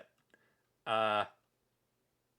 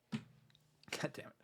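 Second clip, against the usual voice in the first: under-the-breath god damn it; quiet annoyed whisper; whispered curse; 20 dB quieter, much slower than usual. Real, not acted. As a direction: hiss it to yourself, slow and soft. Slips after a long quote.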